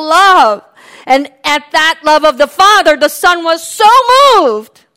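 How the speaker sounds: swelling preaching; building preaching. Real, not acted. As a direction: build to a climax.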